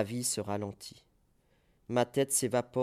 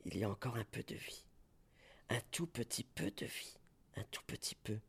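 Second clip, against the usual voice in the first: much softer, fading into a whisper; 11 dB quieter, faster than usual. Acted. Poet sensing last life, fading to a whisper. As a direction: let the voice die away on the last breath.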